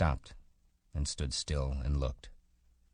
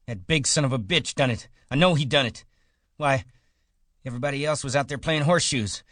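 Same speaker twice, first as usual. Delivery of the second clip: loud, much higher, frantic yelling; furious accusation; 11 semitones higher, 6 dB louder, faster than usual. A narrator's reading. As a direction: shout the blame at everyone. Curly accusing in rage.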